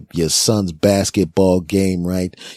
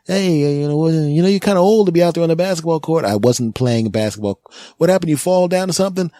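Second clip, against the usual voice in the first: paramedic; 7 semitones higher than usual. Acted. Paramedic questioning in ambulance.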